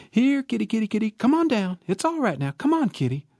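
high, sing-song calling up a tree; coaxing kitty call. Acted. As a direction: coax the kitten down sweetly. Ruth coaxing kitten down.

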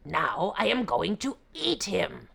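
loud gruff tiger voice; exasperated beast; angry tiger. Acted.

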